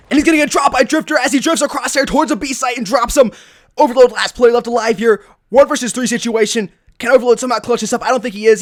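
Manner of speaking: loud, high esports caster call; live play-by-play. Real, not acted. Caster calling a firefight for viewers, excited play-by-play. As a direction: call the action with rising urgency.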